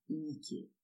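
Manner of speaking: hushed, tender address; softly spoken name. A narrator's reading. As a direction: drop the voice right down on her name. Confessing guilt, addresses her by name.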